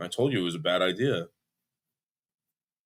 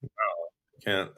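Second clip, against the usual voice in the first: usual speaking voice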